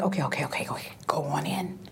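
hushed, resigned whisper; whispered giving in. Retold or imitated. Male nurse finally relenting, whispering. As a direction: back off and let her through.

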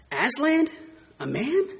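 a stern little animal taken aback; stern Mister Beaver. Acted. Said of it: "said Mister Beaver sternly".

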